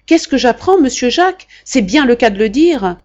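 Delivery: loud, high exclamation; loud high-pitched shout of dismay. A narrator's reading. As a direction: burst in shouting the news. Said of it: "en criant".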